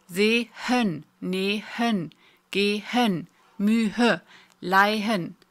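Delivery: "Sehen, nehen, gehen, mühe, leihen" split before an audible H; audible syllable-separating H. Shown as a demonstration. Teacher showing the H can be heard, "Sehen, nehen, gehen, mühe, leihen" split before H. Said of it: "Und du kannst es hören".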